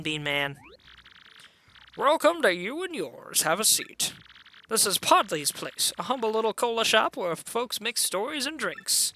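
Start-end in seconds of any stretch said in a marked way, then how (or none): 2.0-9.1 lilting, sing-song host; welcoming bartender. Acted. Bartender welcomes guests to the cola shop, lilting hospitality. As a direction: play an easygoing barkeep with a swooping lilt.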